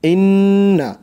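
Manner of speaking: ইন্না with the nn held long through the nose; long nasal hum on ইন্না; nasal ghunnah. Shown as a demonstration. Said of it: "নুন জবর না".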